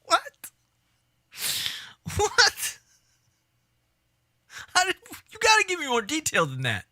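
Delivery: high, disbelieving cry of what; stunned outburst, pitch leaping up; shocked. Real, not acted. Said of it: "shocked reaction".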